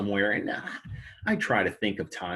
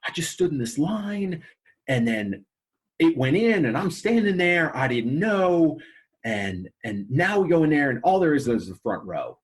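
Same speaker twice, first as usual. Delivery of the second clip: imitating a livid customer, pitched high; retold outrage, climbing higher and louder; 6 semitones higher, 3 dB louder than usual. Father complaining about the holdout line, imitated as livid. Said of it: "and he's livid. He's like".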